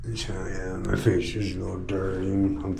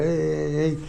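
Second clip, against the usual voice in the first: "Hey, hey, hey" pitched higher as a light mock of cheery passersby; higher mock greeting; 7 semitones higher than usual. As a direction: chirp a higher, sing-song hey, hey, hey. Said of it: "walk in the store and walk back out".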